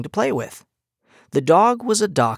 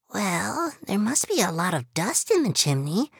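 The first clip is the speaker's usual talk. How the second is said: mouse voice; 8 semitones higher than usual. Acted. Mouse sister proposing a name.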